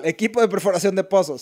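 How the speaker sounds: fast tongue-twister try of "Equipo de perforación de pozos"; rapid, slightly quiet run through the phrase. Shown as a demonstration. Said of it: "díganlo varias veces rápido".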